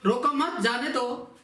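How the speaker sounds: रोको मत as one unit, then जाने दो; group मत with रोको, break before जाने दो; pause after मत. Shown as a demonstration. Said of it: "दूसरा है".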